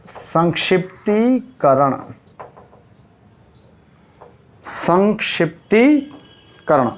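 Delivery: संक्षिप्तीकरण drawn out, long "tee", pause before करण; slow dictation. Shown as a demonstration. Teacher dictating for note-taking, slowly syllable by syllable. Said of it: "note करो फटाफट".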